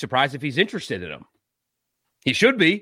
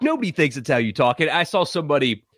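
ordinary delivery

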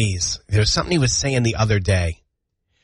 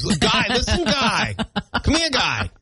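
put-on high, bossy kid with Maine accent; toddler's Maine accent; 9 semitones higher, slower than usual. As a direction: summon Dad in a cheeky Maine accent. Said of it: "he's gonna start using the word guy".

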